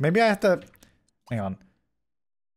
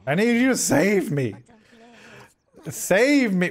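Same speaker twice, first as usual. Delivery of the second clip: pleading; 6 semitones higher, slower than usual. Real, not acted. Begging game character to save them.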